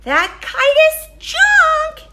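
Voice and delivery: very high, slow, loud bird voice; mock him like a nasty little robin; jeering robins. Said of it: "said the robins".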